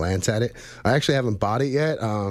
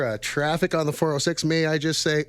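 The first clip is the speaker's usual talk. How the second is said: ordinary delivery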